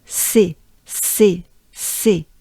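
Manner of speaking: C as a short, crisp "sé"; separate, even repetitions of C with French é; French syllable drill. Shown as a demonstration. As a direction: lead learners through C as "sé". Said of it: "Do it together".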